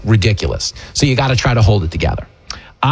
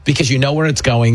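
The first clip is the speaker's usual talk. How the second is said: ordinary delivery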